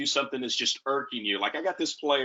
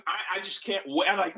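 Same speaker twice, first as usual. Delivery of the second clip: usual speaking voice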